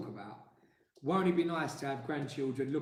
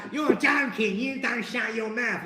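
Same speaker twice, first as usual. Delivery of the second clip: loud, high mock London yell; mimicked London accent; 6 semitones higher, 5 dB louder, slower than usual. Husband mimics wife's usual talk, loud London accent. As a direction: shout it as a rough London-accent impression. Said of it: "She's been like".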